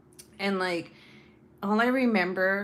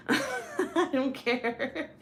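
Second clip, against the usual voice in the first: drawn-out words through real laughter; laughing defiance; 5 semitones higher, much slower than usual. Real, not acted. Wife shrugging off husband's warning about edibles, laughing. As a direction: laugh it off, stretching the words slowly.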